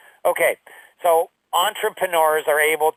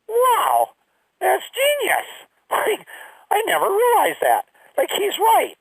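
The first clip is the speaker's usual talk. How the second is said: much higher, wide-eyed gushing voice; mock amazement. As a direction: gush like it's a revelation. Mocking his own naive awe.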